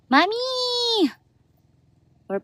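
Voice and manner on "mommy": a high ringing call across the yard. Real, not acted. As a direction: call out to mommy, long and high. Calling out to her mother.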